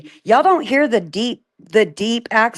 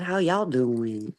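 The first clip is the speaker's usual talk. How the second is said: Yankee imitating country; 8 semitones lower, 8 dB quieter, slower than usual. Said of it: "He said".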